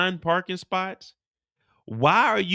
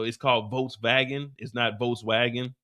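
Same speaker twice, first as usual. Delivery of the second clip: ordinary delivery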